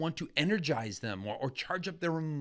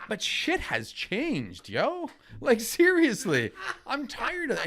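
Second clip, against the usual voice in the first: higher and slower, fed up; exasperated rant; 8 semitones higher, 3 dB louder, slower than usual. Real, not acted. Host venting about old thinking, exasperated and raised. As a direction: pitch it up and drag the words, exasperated. Ranting against outdated marketing idea.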